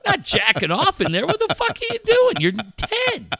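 high, loud mock outrage at the son; mock furious dad. Dad ranting at his ten-year-old about shower length, in high mock outrage. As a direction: rant in exaggerated disbelief, voice cracking up. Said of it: "I go".

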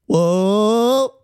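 loud, high, rising whoop; long party whoop; excited whoop. Retold or imitated. Club-goer on their feet for a [name] song, excited whoop.

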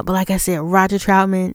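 ordinary delivery

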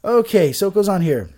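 normal talking voice

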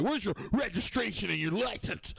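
high-pitched demand in a put-on voice; mock officious cop. Comedian mimics cop asking for papers, high officious voice.

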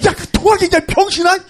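high, loud outburst at a friend; mock angry cursing. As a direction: berate him in imitated exasperation. Retells cameraman cursing at him. (버럭 화내며 욕하는 흉내)

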